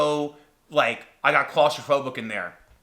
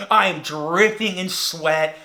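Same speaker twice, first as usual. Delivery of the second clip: ordinary talk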